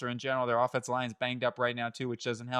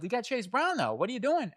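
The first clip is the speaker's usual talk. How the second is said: incredulous; 9 semitones higher than usual. Retold or imitated. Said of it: "you're saying to yourself".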